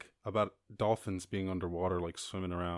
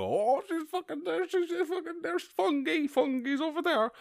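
much higher, louder squealing tourist, pointing it out; excited tourists; 5 dB louder than usual. Retold or imitated. Podcaster imitates tourists spotting Fungi, squealing high with excitement. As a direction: mimic a boatful of thrilled tourists spotting the dolphin. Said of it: "they'll all go".

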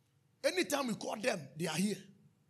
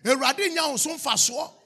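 louder, higher preaching; fervent preaching; 5 semitones higher, 8 dB louder than usual. Real, not acted. Preacher exhorting the congregation, louder and higher. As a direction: stir the hall up.

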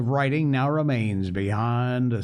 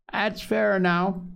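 put-on slow, higher-pitched old man; old pirate Morgan; 8 semitones higher, slower than usual. A narrator's reading. Old Morgan agrees with the sea cook, slow old man's voice. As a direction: draw it out high, as an old crewman.

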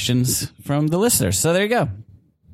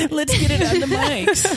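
laughing quote. Retold or imitated. Quoting himself through laughter.

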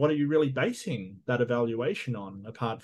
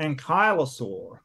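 slow, loud, higher Boston attempt; hammed-up Boston accent, pitched up; terrible Boston accent; 5 semitones higher, 5 dB louder, slower than usual. Shown as a demonstration. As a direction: draw it out in a badly faked Boston accent. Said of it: "Oh, that's terrible".

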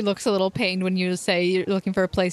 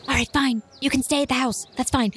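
put-on girlish voice; 5 semitones higher than usual. GM as Cece agreeing.

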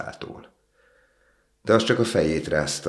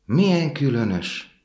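much higher, slow wondering cry; surprised exclaim; 10 semitones higher, 3 dB louder, slower than usual. A narrator's reading. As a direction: marvel at the strange raindrop. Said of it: "kiáltott fel".

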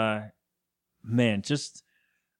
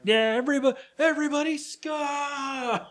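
freaking out; 11 semitones higher than usual. Acted. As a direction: imitate a kid going wild at a party. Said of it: "Kevin is freaking out".

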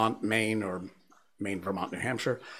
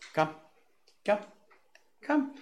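high dog-calling voice; 9 semitones higher, 3 dB quieter than usual. Real, not acted. Coaxing the dog.

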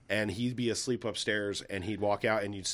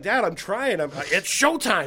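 high, loud exasperated attempt; protest, then rush the catchphrase without the showmanship; failed Beetlejuice attempt; 7 semitones higher, 6 dB louder, slower than usual. Son failing to copy dad, exasperated attempt. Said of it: "You're doing it wrong".

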